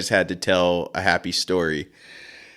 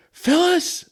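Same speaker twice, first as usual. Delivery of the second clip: much higher calling out Phyllis; dad calling mom. Retold or imitated. Dad calls mom Phyllis, high mock call. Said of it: "he goes".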